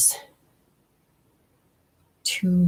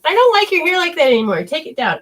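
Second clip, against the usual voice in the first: much higher, fast, louder mother's complaint; channeled mother; 11 semitones higher, 6 dB louder than usual. Retold or imitated. Medium voicing the mother's complaint to her daughter, high and fast. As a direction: rattle it off as the nagging mother, pitched way up. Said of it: "your mother's trying to tell me".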